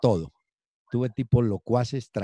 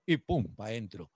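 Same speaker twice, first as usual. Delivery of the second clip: normal talking voice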